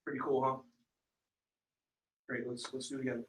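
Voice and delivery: low, very soft and listless; unenthusiastic salesman. Shown as a demonstration. Trainer mimicking a dull sales rep, unenthusiastic. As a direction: show no belief in the product. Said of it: "is that gonna get a customer excited".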